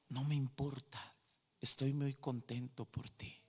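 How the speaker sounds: lightly voiced holy figure, low and soft; quietly reassure, lowering the voice; robed heavenly figure. Robed figure reassuring the soaked sister, lightly voiced, soft comfort. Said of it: "ese personaje me decía".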